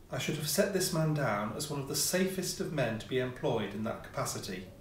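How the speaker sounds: ordinary delivery, nothing marked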